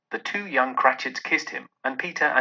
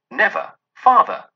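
put-on loud, higher chorus of the children; children crying out; 8 semitones higher, 8 dB louder than usual. A narrator's reading. The children answer their father, loud and high. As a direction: cry it out together, full of feeling. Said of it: "cried they all".

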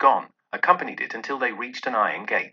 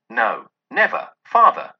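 put-on children crying out; 7 dB louder than usual. A narrator's reading. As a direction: cry it out together, full of feeling. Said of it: "they all cried again".

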